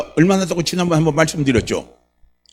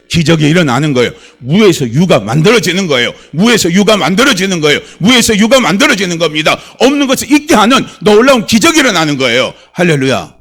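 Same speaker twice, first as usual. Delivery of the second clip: loud, building higher as it goes; fiery preaching climax; 4 semitones higher, 8 dB louder than usual. Real, not acted. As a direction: drive each repeated promise harder up to the hallelujah.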